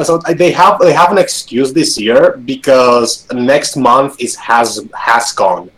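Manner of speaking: ordinary talk, nothing marked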